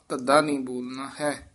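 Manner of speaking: ordinary talk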